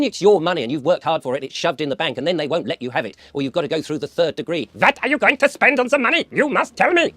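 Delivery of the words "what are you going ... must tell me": hammed-up German interrogator, loud and high; loud, high-pitched comic German accent; naff German accent. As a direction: bark it in a bad mock-German accent. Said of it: "Sorry about the naff German accent there".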